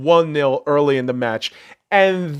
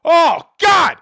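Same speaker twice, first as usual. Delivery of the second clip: loud, high groan of disgust; exasperated shout; 14 semitones higher, 6 dB louder than usual. Real, not acted. Fed up with Redskins critics, real exasperated shout. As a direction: throw your head back and yell it. Fuming rant about Redskins.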